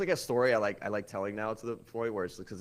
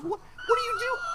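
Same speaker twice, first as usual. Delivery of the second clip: high squeaky shout. Retold or imitated. To the girlfriend on the phone, high squeaky shout. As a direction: shriek it in a squeaky panic. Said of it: "I had a higher pitched voice than her".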